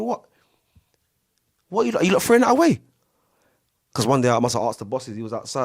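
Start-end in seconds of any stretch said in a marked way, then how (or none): 0.0-2.8 high, fast outraged question; stunned they'd bin it; shocked disbelief. Retold or imitated. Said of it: "I'm saying".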